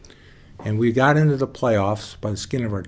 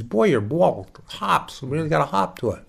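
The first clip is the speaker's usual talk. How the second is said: impressed coach; 6 semitones higher, 3 dB louder than usual. Retold or imitated. Coach impressed by his pitch.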